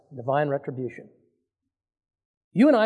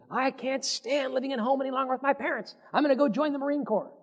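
higher mock complaining youth; sulky kid fed up with parents; lightly mimicked rebellious teen; whiny teenager; 6 semitones higher, 6 dB quieter than usual. Mimics complaining young person.

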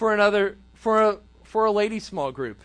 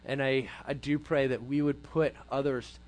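soft, low prayer voice; closing prayer; 9 semitones lower, 8 dB quieter than usual. Real, not acted. Pastor closes the talk praying for the youth group, soft and hushed. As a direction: pray quietly over the group.

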